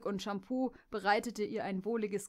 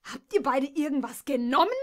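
loud, higher, furious yell; enraged shout; 5 semitones higher, 9 dB louder than usual. Acted. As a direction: shout in disbelief and rage. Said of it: "schrie sie zornentbrannt". (Jess schreit die Stuten zornig an, laut und hoch)

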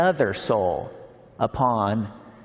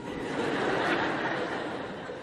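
soft breathy laugh; 9 dB quieter than usual. Real, not acted. Laugh after story.

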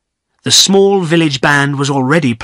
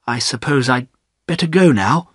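plain reading voice

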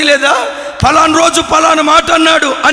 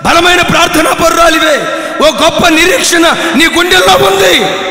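higher, louder preaching; fiery preaching; 5 semitones higher, 4 dB louder than usual. Acted. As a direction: drive the point home. (బిగ్గరగా ప్రసంగం)

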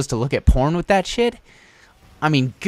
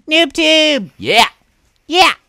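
high, loud whoop; gleeful battle cry; 7 dB louder, slower than usual. Real, not acted. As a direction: cheer your own shot. Celebrates a game weapon.

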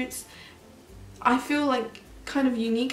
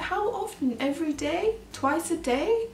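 put-on curious little girl; 7 semitones higher than usual. Girl quizzing about washing.

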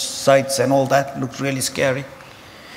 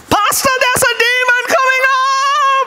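very high, loud alarm scream; panicked shout; 5 dB louder than usual. Acted. Churchgoer warning pastor of a demon, panicked high scream. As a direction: scream a warning at the top of your range. Congregation meets boy dressed as devil.

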